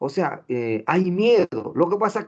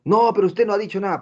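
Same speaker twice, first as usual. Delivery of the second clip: higher, indignant student; student protest; 7 semitones higher, 3 dB louder than usual. Retold or imitated. Student objecting to a surprise test, mock indignant. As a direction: protest as a pupil caught off guard.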